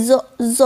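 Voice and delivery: জ said alone, short and buzzing; the z sound. Shown as a demonstration. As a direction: isolate the জ sound twice. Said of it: "এস এর উচ্চারণটা ওরা জ এর মতো করে". (জ ধ্বনিটা আলাদা করে)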